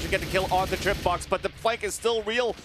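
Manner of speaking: high excited casting call; hyped play-by-play. Real, not acted. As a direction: call the duel at full hype. Play-by-play of a one v one.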